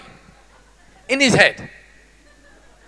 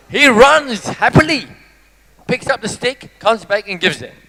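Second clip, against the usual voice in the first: ordinary talk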